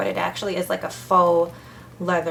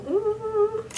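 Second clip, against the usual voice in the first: much higher, thrilled ooh; delighted ooh. Real, not acted. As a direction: coo with delight. Reaction to the pen.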